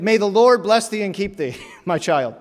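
high, loud hammed-up benediction; over-the-top priestly blessing breaking into a laugh; mock blessing. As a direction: playfully intone a grand fatherly blessing. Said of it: "He did".

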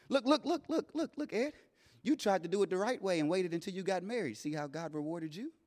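very soft, hushed taunting voice; inner whispers. Retold or imitated. Doubt taunting the grieving father in the hospital, hushed. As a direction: murmur the doubts under your breath.

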